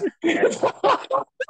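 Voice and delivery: high-pitched laugh; real amused laughter. Real, not acted. Laughing at the retold story.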